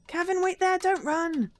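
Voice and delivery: put-on high, loud mother telling the boy off; scolding mother. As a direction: catch the kid half dressed. Mother chasing child.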